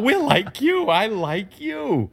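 high-pitched fake chumminess; mock ingratiating. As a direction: gush insincerely, drawing out the flattery. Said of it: "making them believe that you are like them".

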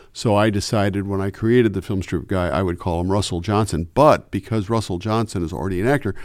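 ordinary delivery

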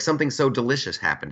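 ordinary delivery

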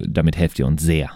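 ordinary talk

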